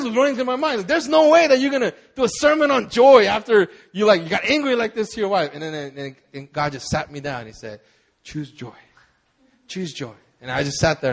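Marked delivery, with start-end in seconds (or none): 0.9-5.5 high, loud acted inner scolding; agitated self-reproach. Thought running through preacher's mind after fight, agitated. 8.2-10.1 soft, lowered voice, gently spoken; a gentle inner voice, said softly; calm prompting. Retold or imitated. God sat him down and spoke, calm and quiet. God's calming word after the fight.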